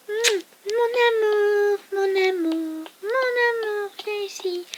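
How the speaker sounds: voix d'amoureux très aiguë et lente, qui s'éteint; amoureux transi. Acted. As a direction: appelle son amour d'une voix haute et pâmée. Coin des amoureux, amant imité. (au coin des amoureux, il appelle son amour en voix aiguë et langoureuse)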